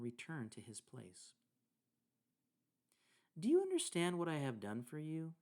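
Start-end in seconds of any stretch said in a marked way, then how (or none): 3.4-5.3 lightly voiced teacher, higher and louder; Jesus' question. A narrator's reading. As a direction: read it out as a teacher addressing followers, a little raised. Reads Jesus' question to disciples.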